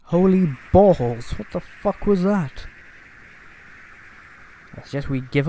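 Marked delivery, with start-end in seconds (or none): 0.0-2.7 high, drawn-out disbelief; stunned, stretched-out exclamation; shocked outburst. Acted. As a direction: exclaim it on behalf of the whole stunned group. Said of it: "I speak for everyone when I say".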